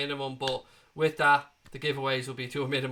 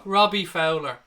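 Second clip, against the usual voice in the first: louder, slow and deliberate, every syllable clear; slow careful correction; 3 semitones higher, 9 dB louder, slower than usual. Real, not acted. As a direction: spell the name out slowly after fumbling it. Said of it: "I can't even say it now".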